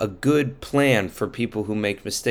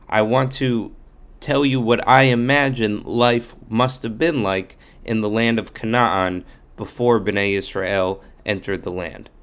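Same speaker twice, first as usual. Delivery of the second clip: ordinary delivery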